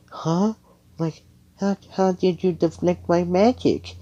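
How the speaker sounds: very high, incredulous questioning; astonished girl. Acted. Noelle sees her magic deflected, astonished.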